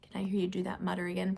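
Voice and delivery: fast, hushed whisper. Real, not acted. Reader coaxing children to mutter, fast whisper. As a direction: whisper the question quickly.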